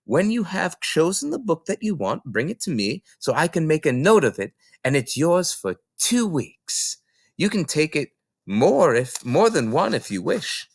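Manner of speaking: concerned librarian thinking aloud; librarian Mrs Phelps. Acted. Mrs Phelps worrying about Matilda, hesitant librarian voice.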